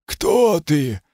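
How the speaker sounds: hollow stone voice, pitched high; grinding stone statue. Acted. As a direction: ask like a statue waking. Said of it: "повторил каменный голос".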